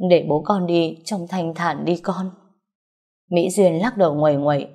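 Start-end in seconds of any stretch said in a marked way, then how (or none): none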